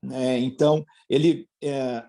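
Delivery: normal talking voice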